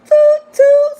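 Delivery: loud high "toot, toot" like a horn; horn imitation. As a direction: honk it out like a horn. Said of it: "let me toot my own horn".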